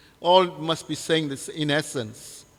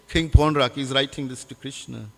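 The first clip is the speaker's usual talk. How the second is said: normal talking voice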